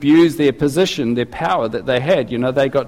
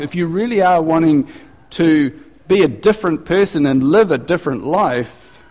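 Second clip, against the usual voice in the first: ordinary talk, nothing marked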